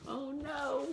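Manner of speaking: usual speaking voice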